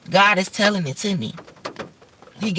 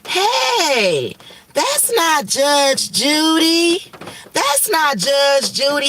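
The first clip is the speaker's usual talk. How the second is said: put-on high, drawn-out toddler protest; mock high kid's shout; little boy voice; 7 semitones higher, much slower than usual. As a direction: play the three-year-old calling out in court. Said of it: "Denzel was in the court like".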